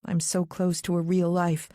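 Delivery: plain reading voice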